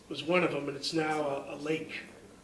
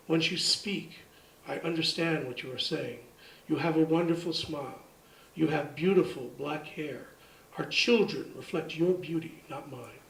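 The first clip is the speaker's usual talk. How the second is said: soft, lowered poetry reading; poem recital; 3 semitones lower, 5 dB quieter than usual. Acted. Poet reads Sequel for my wife, soft recital. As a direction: read it quietly, line by line.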